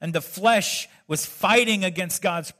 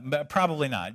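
ordinary talk

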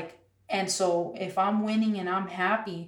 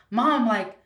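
exasperated coaxing; 6 semitones higher, 7 dB louder than usual. Retold or imitated. Retold coaxing mom for photo.

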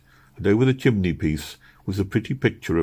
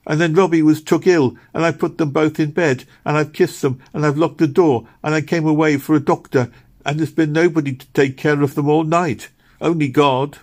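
high, louder anxious outpouring; anxious child's account; 9 semitones higher, 4 dB louder than usual. A narrator's reading. Meg tells Missus Blossom the children are alone, anxious outpouring. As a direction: pour out the worry all at once.

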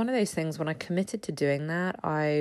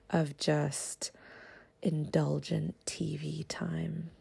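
guilty little whisper; slow whisper like a confession; 8 dB quieter, slower than usual. Real, not acted.

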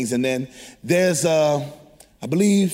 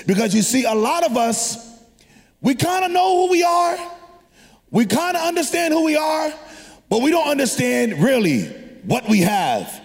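raised, ringing preacher voice; preaching; 7 semitones higher than usual. Acted. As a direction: preach it out to the church. Preacher builds to a shout.

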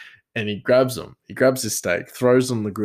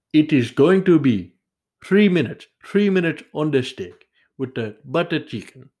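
put-on high, loud caricature Indian accent; broad, botched Indian restaurant accent; attempted Indian accent; 7 semitones higher, 5 dB louder than usual. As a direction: try out a hammy Indian accent. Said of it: "I don't know why I can't do an Indian accent".

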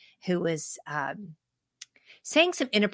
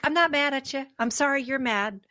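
higher, lightly voiced sweet sass; a hint of Paula Patton; 6 semitones higher than usual. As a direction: quote her cheerfully, amused. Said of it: "She's kinda like".

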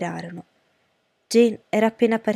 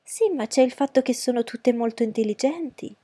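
higher-pitched, insistent wife; fussy mother; 5 semitones higher than usual. Acted. Wife defending her daughters to her husband, in a high fussy voice. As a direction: lift the voice as a proud, chattering mother. Voices Mrs. Bennet in the story.

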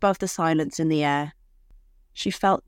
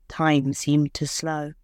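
ordinary delivery, nothing marked

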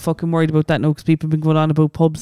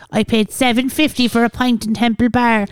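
lightly mocking higher-pitched moan; mock complainer; 6 semitones higher than usual. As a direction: imitate an outraged punter, pitched up. Mimicking people complaining about prices.

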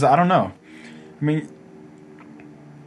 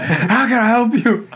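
high, loud, slow cashier voice; mock sweet cashier; 13 semitones higher, 7 dB louder, slower than usual. Friend repeating the cashier's line: high slow mock sweetness. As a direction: ham up the polite cashier. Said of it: "He's like".

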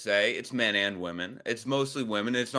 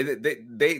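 usual speaking voice

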